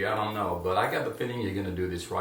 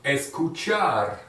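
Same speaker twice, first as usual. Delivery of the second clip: escuchar drawn out very slowly and louder; slow model; 3 semitones higher, 5 dB louder, much slower than usual. Shown as a demonstration. Teacher slowly models escuchar for the class. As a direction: stretch escuchar out for learners. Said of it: "which is, come on".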